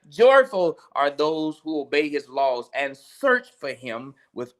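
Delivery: preacher rereading the psalm to close; preaching. Acted. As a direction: proclaim the verses with force.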